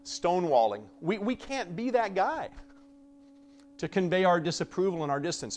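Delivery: ordinary talk, nothing marked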